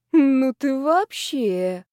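slow, scandalised drawl; drawn-out drawl. A narrator's reading. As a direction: stretch each word in disbelief. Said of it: "протянула Лёлик".

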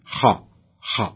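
خاء heavy and clearly open; the correct open خاء; correct heavy kha. Shown as a demonstration. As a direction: say خاء with full heaviness, mouth open. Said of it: "الصحيح".

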